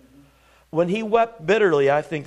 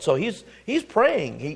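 normal talking voice

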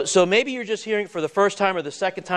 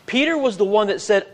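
ordinary delivery

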